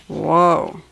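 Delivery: plain reading voice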